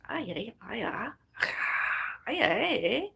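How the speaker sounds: mock Irish cheer building up; playful mock Irish cheer.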